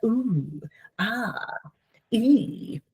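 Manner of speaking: soft mock primping coos rising in pitch; primping noises. Therapist imitates the Grinch trying on outfits, soft cooing. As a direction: coo at the mirror like a vain Grinch.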